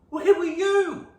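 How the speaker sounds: high loud demanding question; loud demanding parent; mock yell. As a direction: bark it out as a worried parent. Parents demanding where he was.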